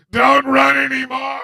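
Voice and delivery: hammed-up tearful wail; six-year-old pleading. Child begs the runaway horse to stop, mock wailing plea. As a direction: break into a mock crying shout. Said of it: "my six-year-old strength, like".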